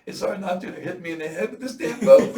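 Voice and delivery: high, very loud comic complaint; loud, laughing mock outrage; exasperated protest. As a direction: protest loudly over the racket, half laughing. Swimmer's exasperated retort, acted out.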